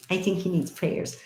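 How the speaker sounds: ordinary talk, nothing marked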